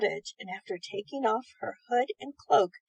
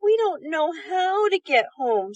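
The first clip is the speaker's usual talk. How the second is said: put-on worried boy; 6 semitones higher, 5 dB louder than usual. A narrator's reading. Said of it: "said Teddy".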